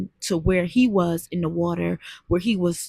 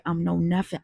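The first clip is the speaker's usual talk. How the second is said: ordinary talk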